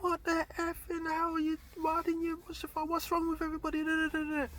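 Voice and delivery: swearing rant. Retold or imitated. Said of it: "swearing and blind".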